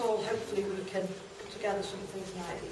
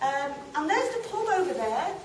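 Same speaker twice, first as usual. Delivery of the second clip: put-on lively, swooping storytelling in a young girl's role; chatty young girl character; 2 semitones higher than usual. Girl showing the audience her street and mates, chatty and animated. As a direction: chat brightly to the audience as a girl reminiscing. Stage monologue continues, girl reminiscing.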